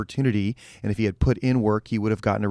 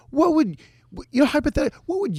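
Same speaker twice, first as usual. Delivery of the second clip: high voice. Acted. Mock question in squeaky voice.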